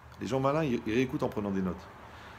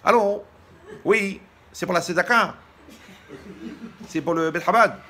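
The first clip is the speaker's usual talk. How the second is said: put-on much higher, much louder old lady answering the phone; old wife voice; 8 semitones higher, 11 dB louder than usual. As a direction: squeak it out like a confused old wife. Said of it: "l'autre, elle me répond".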